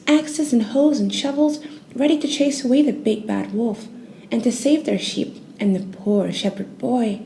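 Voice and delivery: ordinary delivery, nothing marked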